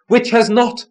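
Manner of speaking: high, loud crying question. A narrator's reading. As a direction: throw the question up as a shrill cry. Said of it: "the Fulmer cries".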